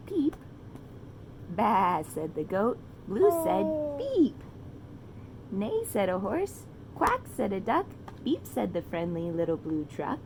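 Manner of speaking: put-on reading to children. A narrator's reading. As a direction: read it like a bedtime story to a toddler. Reading animal sounds to child.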